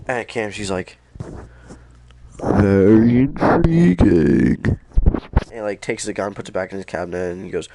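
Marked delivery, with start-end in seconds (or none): 2.4-4.8 slow, loud, savoured intrigue; smug villain drawl. Retold or imitated. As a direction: purr it like a pleased villain. Kamsky drawled.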